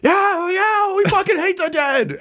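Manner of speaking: very high heckler's cheer; crowd yell. Acted. Someone in the crowd backing Reginald, high yell. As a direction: yell it from the stands. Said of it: "as someone yells that".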